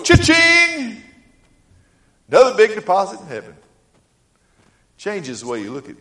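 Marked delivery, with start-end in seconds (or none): 0.0-1.0 very high, loud ringing till sound; joyful, loud "Cha-ching"; cash register cha-ching. Shown as a demonstration. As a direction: shout it out like a jackpot. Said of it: "just go".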